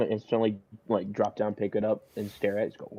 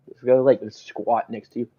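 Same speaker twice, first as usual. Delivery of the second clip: ordinary talk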